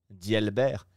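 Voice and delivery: ordinary delivery, nothing marked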